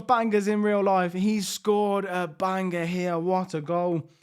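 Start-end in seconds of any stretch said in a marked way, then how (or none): none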